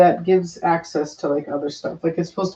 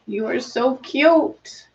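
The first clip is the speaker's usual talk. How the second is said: slow, higher-pitched coo; affectionate cooing; 5 semitones higher, much slower than usual. Real, not acted. Doting on a cute little one, cooing. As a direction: draw it out adoringly, pitched up.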